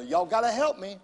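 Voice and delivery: usual speaking voice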